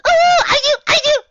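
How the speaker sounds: high, loud, frantic eagerness; eager shout. Acted. Pinkie begging to answer, high loud eager squeal. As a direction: squeal to be picked. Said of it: "Pinkie frantically waved her hoof".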